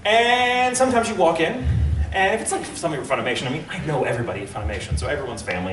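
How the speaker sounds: usual speaking voice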